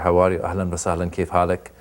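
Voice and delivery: quick Arabic-accented English sliding into Arabic. Shown as a demonstration. Comedian showing the interviewer how Arabs talk, fast Arabic accent. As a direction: demonstrate a rapid-fire Arabic accent. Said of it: "Arabic accent is very fast".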